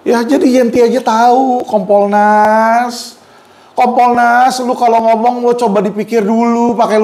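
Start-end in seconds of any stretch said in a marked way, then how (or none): 1.7-3.2 slowly drawn-out name, called out. Real, not acted. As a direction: stretch the name out like summoning them. Calling out to Kompolnas directly.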